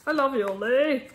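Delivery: voicing the chocolates. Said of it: "They're like".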